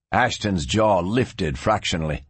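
usual speaking voice

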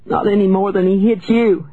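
put-on high, wounded child's outcry; crying out. A narrator's reading. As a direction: hurl it back in a high voice. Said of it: "she cried".

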